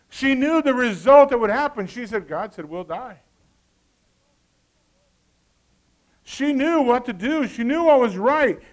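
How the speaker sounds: loud, driving sermon delivery; preacher stressing what Eve knew, loud; full-force preaching. Acted. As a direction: drive the point home to the church.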